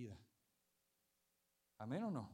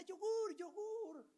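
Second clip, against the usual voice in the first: put-on much higher squeal of a thrilled child; excited little girl; 13 semitones higher, much slower than usual. Pastor voicing the little girl over yogurt, excited squeal. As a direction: squeal like a four-year-old seeing a treat. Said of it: "la niña emocionada".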